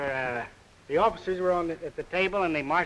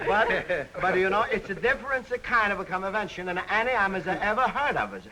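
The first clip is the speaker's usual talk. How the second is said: put-on comic Greek accent; 2 semitones higher than usual. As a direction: play a jolly Greek immigrant pitching the slogan. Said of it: "how about a little example of that dialect you talk of".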